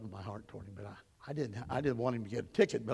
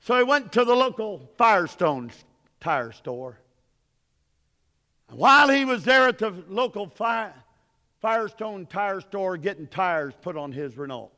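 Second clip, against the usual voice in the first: raised, higher preacher's delivery; preaching; 6 semitones higher, 10 dB louder than usual. Acted. Preacher telling a congregation about a tire store visit, raised preaching. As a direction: punch the phrases out, loud, with pauses.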